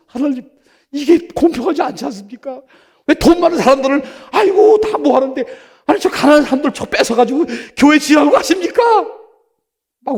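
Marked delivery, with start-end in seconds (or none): 0.1-8.8 high, loud retold complaint to God; raised-voice appeal against unfairness; impassioned protest. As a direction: plead indignantly, building to a shout. Retells his protest to God.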